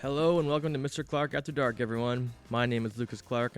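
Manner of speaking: ordinary delivery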